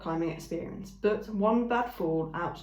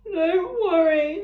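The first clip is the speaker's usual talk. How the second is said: much higher, loud, slow tearful voice; sniffling ram; 11 semitones higher, 9 dB louder, much slower than usual. A narrator's reading. Ram promising the robot, sniffling. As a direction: sniffle the words out. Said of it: "the ram sniffled".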